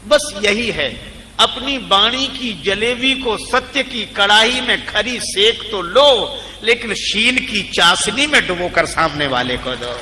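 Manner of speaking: emphatic preaching. Real, not acted. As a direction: drive the moral home to the congregation, stretching key words. Drives home the moral. (ज़ोरदार प्रवचन)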